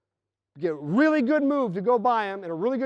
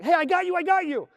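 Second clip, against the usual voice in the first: higher, eager mock reply; passer answering; 10 semitones higher, 4 dB louder than usual. Passer answering the cutter, raised and eager. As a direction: answer your teammate on the floor. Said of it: "you're like".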